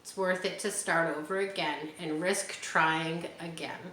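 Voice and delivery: ordinary delivery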